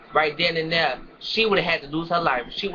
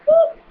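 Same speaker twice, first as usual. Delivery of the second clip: whoop sound effect; 9 dB louder than usual. Retold or imitated. Imitating arm twist noise.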